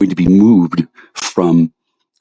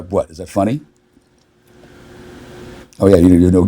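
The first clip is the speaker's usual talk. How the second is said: usual speaking voice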